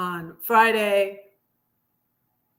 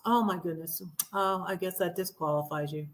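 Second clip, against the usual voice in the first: lower, softer sarcastic regret; mock sympathy; 4 semitones lower, 9 dB quieter, faster than usual. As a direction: drop low and soft in feigned sympathy. Sarcastic sympathy after mock realization.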